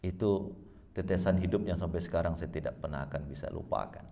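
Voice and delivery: normal talking voice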